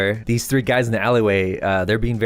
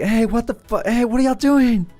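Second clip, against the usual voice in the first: high-pitched, indignant yell; acted outraged call, voice way up; angry challenge; 13 semitones higher than usual. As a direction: holler after people running off. Acts guy yelling after them.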